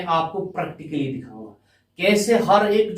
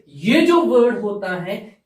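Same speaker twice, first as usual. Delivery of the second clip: ordinary talk, nothing marked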